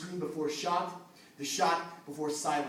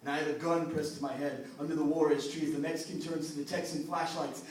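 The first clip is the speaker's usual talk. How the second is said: normal talking voice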